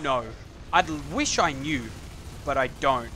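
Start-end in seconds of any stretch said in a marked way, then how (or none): none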